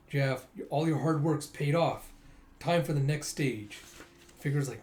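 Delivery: ordinary delivery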